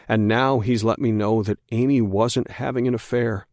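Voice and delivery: plain reading voice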